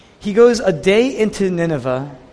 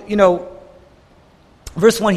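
normal talking voice

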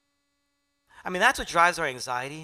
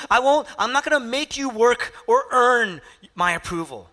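higher and louder, fervent reassurance; earnest loud promise; 9 semitones higher, 8 dB louder than usual. Acted. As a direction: proclaim it as a heartfelt promise. Preacher voicing Jesus' promise.

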